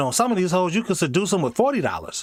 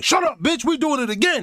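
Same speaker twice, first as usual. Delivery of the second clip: hammed-up higher angry yell; mock shout; 11 semitones higher than usual. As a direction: snap at her like a bossy man. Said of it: "He was like".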